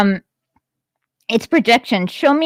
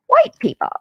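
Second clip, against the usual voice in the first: mocking emphasis. Sarcastic swap in quoted text.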